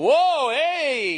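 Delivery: high, loud, drawn-out catcall; drunken leering holler. Retold or imitated. Drunk guy hollering at a lady passing, loud imitation. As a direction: whoop it out like a rowdy drunk. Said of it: "he does this whole".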